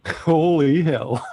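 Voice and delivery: slow, amused laughing repeat. Retold or imitated. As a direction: chuckle the words out slowly. Echoing the clip while laughing.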